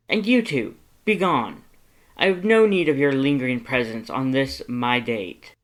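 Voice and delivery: high, loud and imperious, sending them away; a grand noble ordering his lackeys off; haughty lord dismissing servants. Acted. Lord banishing his two servants from his date, commanding and loud.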